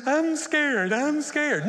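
high, loud scared wail; hammed-up fear; mock terror. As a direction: whimper it out loud like a terrified passenger. Clinging to controls in panic.

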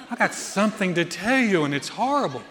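hammed-up voice of dreadful news; mock-ominous mother, played for laughs. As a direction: exaggerate the foreboding in a put-on voice. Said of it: "it had that sound like".

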